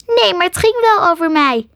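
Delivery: high, squeaky objection; little creature sticking up for itself; protesting squirrel. A narrator's reading. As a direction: pipe up indignantly as a small animal.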